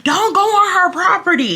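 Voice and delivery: high-pitched imitation of a dad yelling; retold dad's yell. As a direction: yell a stretched-out warning, high. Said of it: "My dad starts yelling".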